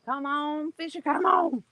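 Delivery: high, eager coaxing. Real, not acted. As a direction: urge the fish on. Coaxing a fish.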